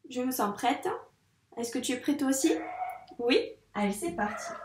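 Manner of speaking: usual speaking voice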